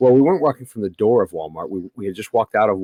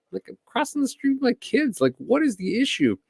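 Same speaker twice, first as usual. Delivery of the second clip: exasperated retort; 12 semitones higher, 4 dB quieter than usual. Retold or imitated. Retold argument with Karen.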